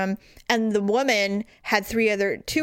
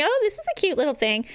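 higher, fast mock-airy browsing; oblivious cooing; 8 semitones higher, faster than usual. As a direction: play the distracted shopper. Browsing the Magnolia section.

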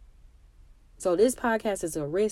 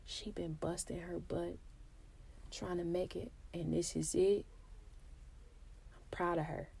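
soft whispered quote of friends; hushed imitated friends; 15 dB quieter than usual. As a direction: murmur it like friends talking. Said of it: "people that know me gonna be like".

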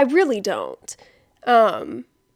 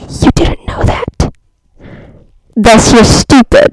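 slow, loud, breathy scandalized verdict; slow hammed-up horrified sneer; mock horrified judgment; 13 dB louder, slower than usual. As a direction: deliver it like a dramatic accusation.

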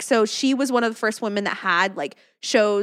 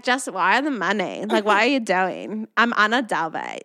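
slow, mock fake-German heiress accent; put-on European heiress accent, unhurried; Anna Delvey accent; 3 semitones lower than usual. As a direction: imitate the heiress's weird accent, taking it slowly. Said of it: "Joel and I talked in that voice".

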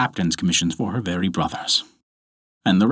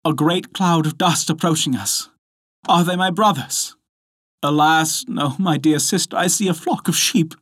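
high woman's voice, lightly acted; pitched up, hopeful then dismayed; anxious sisters; 8 semitones higher than usual. A narrator's reading. Sisters watching for their brothers, anxious.

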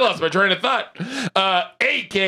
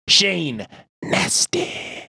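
slow announcer intro; much slower than usual. Acted.

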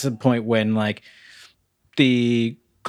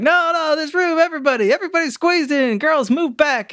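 much higher, hearty insistent dad voice; jovial dad insisting. Retold or imitated. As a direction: play the cheerful dad cramming everyone in. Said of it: "Dad is just like".

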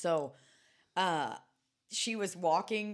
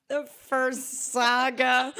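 high, loud, drawn out with laughter; amused mock echo; 8 semitones higher, 7 dB louder, much slower than usual. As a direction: parrot it slowly, cracking up. Laughing mock echo of co-host's phrase.

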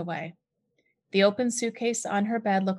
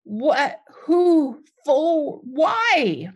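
high, loud stammered questions; sputtering in shock; flustered; 8 semitones higher, 7 dB louder, much slower than usual. A narrator's reading. Mother sputtering after the fire, loud flustered questions.

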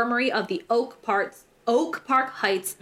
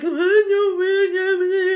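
high, slow fake sobbing; mock crying; 9 semitones higher, 5 dB louder, much slower than usual. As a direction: wail it like a fake crybaby. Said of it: "No longer crying".

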